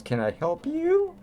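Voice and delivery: high, slow, polite mother at the door; mock mother. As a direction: imitate the wary mother, pitched well up and drawn out. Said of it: "the mother looks at Toby and goes".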